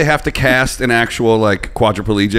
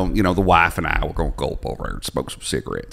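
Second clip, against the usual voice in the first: put-on good ol' boy trailing down into a low Southern murmur; effeminate Southern accent; 4 semitones lower than usual. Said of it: "like, an effeminate Southern accent".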